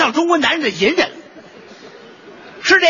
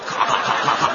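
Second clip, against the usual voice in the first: clicking noises; 10 semitones lower, 4 dB quieter than usual. Acted. Imitated keyboard clicking.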